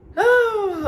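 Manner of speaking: teary sigh. Real, not acted. Emotional moment about pregnancy.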